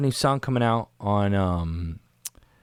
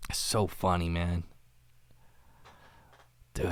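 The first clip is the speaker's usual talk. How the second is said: ordinary delivery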